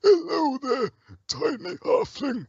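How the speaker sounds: very high, strained creature voice with a slow finish; troll character voice. Acted. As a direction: put on a high game-troll voice, drawing out the last words. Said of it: "we've got a troll, and he's like".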